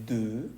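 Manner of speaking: "de" as a long deu with rounded lips; French EU sound. Shown as a demonstration. Teacher gives the word for two, long eu. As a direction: draw out "de" on a rounded vowel. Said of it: "que se dice de".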